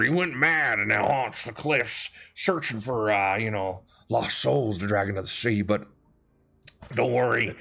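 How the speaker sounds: lightly voiced boisterous huckster; grandiose tiefling showman. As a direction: sell the spooky legend to the tourist families. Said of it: "he's kinda put on a grandiose effect".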